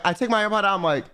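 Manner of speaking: ordinary talk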